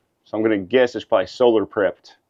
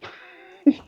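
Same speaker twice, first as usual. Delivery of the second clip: laughing; 7 semitones higher than usual. Real, not acted. Laughing at joke.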